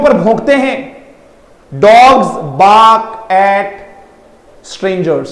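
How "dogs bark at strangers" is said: each word drawn out very slowly; slow model sentence. Shown as a demonstration. Teacher modelling an English sentence slowly. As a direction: lay the sentence out slowly for learners. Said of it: "मैं हमेशा focus करता हूं pronunciation".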